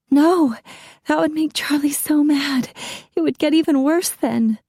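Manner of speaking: high, anxious refusal; panicked wife refusing to press charges; frightened pleading. A narrator's reading. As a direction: beg her not to provoke him. Wife fears angering husband.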